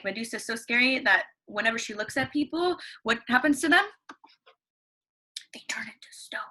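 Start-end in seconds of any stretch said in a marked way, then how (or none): 5.5-6.4 breathy whisper for suspense; spooky whisper. Acted. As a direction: hush it like a scary secret. Teacher answers own question dramatically.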